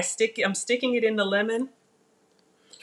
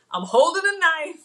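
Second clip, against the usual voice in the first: high, louder flustered blurt; 8 semitones higher, 5 dB louder than usual. Real, not acted. Host flustered while holding a knife on camera. Laughing through emotion.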